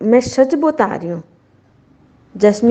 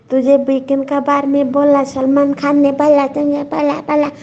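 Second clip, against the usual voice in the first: mocking Arshi; 6 semitones higher than usual. Vlogger mocking how Arshi talks, in a higher mocking voice. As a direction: parody her big-mouthed nagging, pitched up. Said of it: "मुंह खोल खोल के बात करती है".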